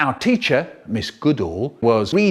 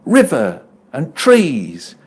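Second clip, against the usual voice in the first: put-on high, louder reading of a children's poem, slowing at the close; poem recital; 5 semitones higher, 6 dB louder than usual. Reading The Swing aloud for the audience, recital voice. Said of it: "willing to perform The Swing".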